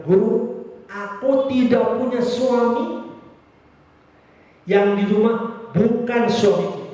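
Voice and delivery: a humbled woman confessing to the teacher; Samaritan woman. Retold or imitated. Samaritan woman answers Jesus. (perempuan Samaria mengaku, lebih tinggi dan pelan)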